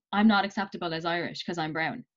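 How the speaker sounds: usual speaking voice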